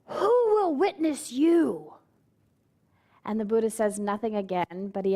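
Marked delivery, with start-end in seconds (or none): 0.1-1.9 higher, slow, a hint of a menacing demon; demon challenge. Angry demon Mara challenges the meditating Buddha, slow menacing demon voice. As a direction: draw it out like a mocking demon.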